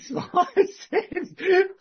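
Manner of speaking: laughs through the words; a laugh breaks into the line. Real, not acted. Laughing while retelling.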